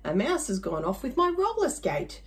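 ordinary delivery

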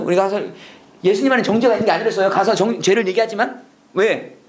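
usual speaking voice

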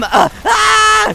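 high, loud scream. Pretend. Gamer in the middle of the action, screaming.

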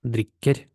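"Дріккер" with stressed short "і" before a double k; short first vowel in "Дріккер", rising ending. Shown as a demonstration. Teacher explains double consonant, "Дріккер" with short "і". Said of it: "але коротка, тому що у нас подвоєна ко".

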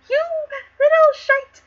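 high, loud outburst; furious insult. Acted. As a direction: explode at him in sudden fury. Acted outburst of the raging king.

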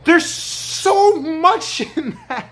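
high, slow, laughing through the words. Real, not acted. Preacher marvelling at the verse, laughing.

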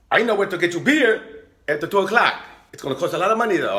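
high, pushy cabbie voice pitched well up; hustling taxi driver. Retold or imitated. Comic retelling of the cab driver's pitch, high hustling voice. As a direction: sell it like a shady late-night cabbie.

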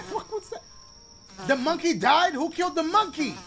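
high, loud shocked questions; aghast at the dead monkey; shocked disbelief. Real, not acted.

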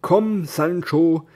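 weary knight. Acted. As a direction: speak like a defeated but proud knight.

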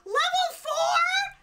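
high, loud, drawn-out shout; amazed disbelief. Real, not acted. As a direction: shout it in astonishment. Stunned by hype train level.

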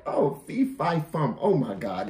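usual speaking voice

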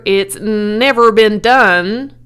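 loud, slow pronouncement; loudspeaker announcer. A narrator's reading. Loudspeaker turns down the owner, loud slow announcer. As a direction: draw out the official ruling. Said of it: "said the loudspeaker".

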